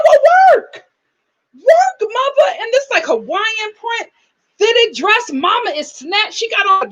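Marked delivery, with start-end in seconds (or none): 0.1-2.5 high, loud mock cheer; excited hype. Vlogger hyping up Dylan's stunning mother, high and loud. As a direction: cheer her on, hyped. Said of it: "I said".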